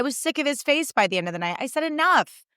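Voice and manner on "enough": high, falling cry of exasperation; exasperated, dramatic protest; fed-up outburst. Retold or imitated. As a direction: put your foot down, pitched up. Retelling being fed up with ads.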